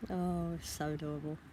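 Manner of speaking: ordinary talk, nothing marked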